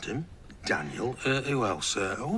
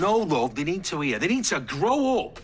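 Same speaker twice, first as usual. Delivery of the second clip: firm, raised-pitch telling-off; heated and insistent; exasperated insistence; 6 semitones higher than usual. Acted. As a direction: overrule them, voice climbing. Exasperated, overrules partner in row.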